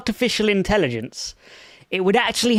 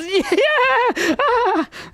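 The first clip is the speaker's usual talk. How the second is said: high hysterical cackle; unhinged high laugh; manic laugh. Real, not acted. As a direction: laugh like you've lost it.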